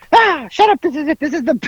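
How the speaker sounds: high-pitched irritated imitation; mock snapping. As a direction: squawk back like the flustered driver. Imitating driver arguing about hotel.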